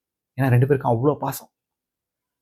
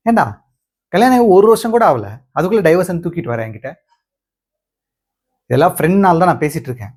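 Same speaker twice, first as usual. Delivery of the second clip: raised, high-pitched telling-off of a friend; exasperated scolding; 4 semitones higher, 3 dB louder than usual. Acted. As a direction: scold him in disbelief. Scolding friend over hasty divorce. (நண்பனை ஆதங்கத்தோடு திட்டுவது)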